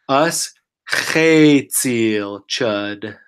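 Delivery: "us chetzil chud" said very slowly and carefully; slow demo. Shown as a demonstration. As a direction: lay out "us chetzil chud" sound by sound. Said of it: "So let's say".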